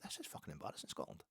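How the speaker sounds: a bitter whispered aside; disgusted whisper. Retold or imitated. A fan whispering disgust at his own team's poor play. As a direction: hiss it under your breath in disgust.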